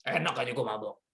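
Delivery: low, soft mumbled denial; drunk denial. Retold or imitated. Drunk man denying he's drunk, low and mumbled. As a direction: play the drunk brushing it off. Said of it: "pasti dia marah".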